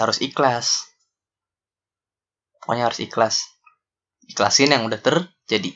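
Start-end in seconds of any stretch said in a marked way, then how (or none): none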